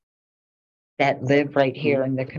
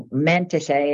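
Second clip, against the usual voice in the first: usual speaking voice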